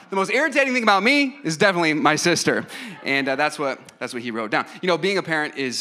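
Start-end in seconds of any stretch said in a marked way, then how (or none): 0.1-2.7 higher, louder, emphatic imitation of a son; mock-exasperated kid. Young son thinking about his irritating sister, mock-exasperated. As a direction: act out a child's certainty with hammed-up annoyance. Acting out son's indignant thought.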